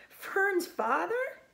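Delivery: high, slow sobbing cry. Acted. Wilbur sobbing about Fern's father, high and slow. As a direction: draw it out through tears. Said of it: "sobbed Wilbur".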